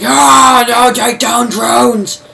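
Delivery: high, loud mock protest yell; hammed-up outraged shouting, drawn out; mad rioter shout. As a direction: holler like an angry mob of Brits. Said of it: "how Brits are when they get mad".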